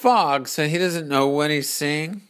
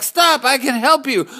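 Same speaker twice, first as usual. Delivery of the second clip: high, loud urgent plea; pleading shout; 11 semitones higher, 5 dB louder than usual. Acted. Courage shouts to calm the terrified man. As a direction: beg him to stop.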